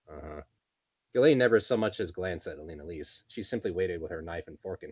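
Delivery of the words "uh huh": soft, low absent uh-huh; distracted grunt. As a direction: grunt without listening. Ghislaine half listening.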